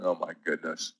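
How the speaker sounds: low, soft groaning dismay; deflated groan at a losing stock; mock dismay. Real, not acted. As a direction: sink down in mock despair at the chart. Reacting to Sava chart.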